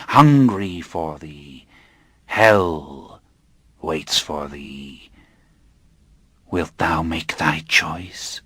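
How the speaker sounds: slow, menacing ritual intonation; sinister cult elder. Acted. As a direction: tempt him with slow dread. Robed inquisitor offers the choice.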